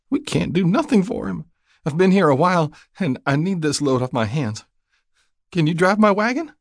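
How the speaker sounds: high-pitched, pleading country woman; distraught new widow. A narrator's reading. Young widow asks passing rider for help, distraught.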